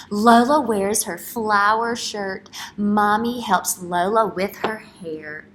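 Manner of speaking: slow, loud storybook read; reading to children. Acted. Teacher reading a picture book aloud to young children, storybook voice. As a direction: read it out big for the class.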